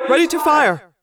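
"Ready to fire" high and loud, falling at the end; command, not a question. A narrator's reading. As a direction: call "Ready to fire" as an order. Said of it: "It wasn't a question".

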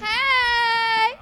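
loud high greeting shout. Acted. Cohost greeting listeners with a loud shout. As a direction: yell a big hello.

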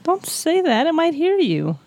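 high, cooing protective voice; playfully babying sympathy; mock pity. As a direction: coo as if shielding someone's feelings. Teasing, pitying the laptop.

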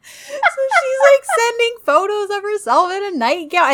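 high-pitched, loud, helpless giggling; laughing while talking. Real, not acted. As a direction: squeal the words through real laughter. Cracking up retelling the selfie line.

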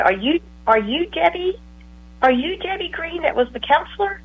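high, rising recognition question; checking in disbelief if it's really her; surprised question. Retold or imitated. Former client recognizing her old counselor, rising in surprise.

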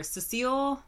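ordinary talk

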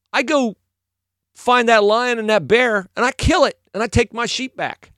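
high, emphatic boast; David. Retold or imitated. David persuading the king he can fight, boastful. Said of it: "And David says".

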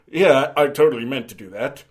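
a light smug mock of a bumbling spy; smug arrogance. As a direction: toss it off like a smug fool covering a blunder. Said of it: "It's the arrogance that gets me".

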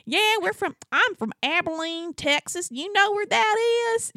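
high-pitched chatty Texan; thick Texas accent. Retold or imitated. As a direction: imitate a Texan introducing himself. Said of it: "he has the thickest Texas accent".

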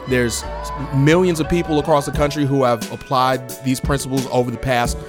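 ordinary talk

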